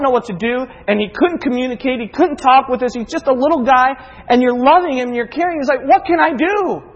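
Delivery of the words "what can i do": high-pitched, re-enacted desperation; acted-out pleading, pitch raised; helpless plea. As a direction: cry out as a helpless parent. Retold helpless parent's cry.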